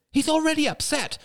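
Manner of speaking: high, distressed woman's protest; crying out. A narrator's reading. Mother protests to shouting father, crying out. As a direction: cry out in protest, pitch shooting up. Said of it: "she cried".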